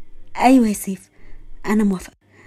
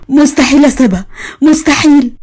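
مستحيل cried out louder, voice breaking; crying denial; 2 semitones higher, 5 dB louder than usual. Acted. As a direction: wail مستحيل in tears. Whispered, then cried. (صرخة باكية)

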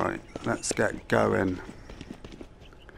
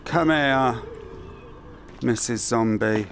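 normal talking voice

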